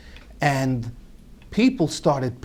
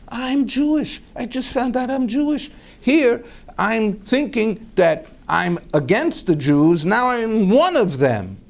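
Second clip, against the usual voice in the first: stunned; 9 semitones higher than usual. Retold or imitated. Stunned realization to himself.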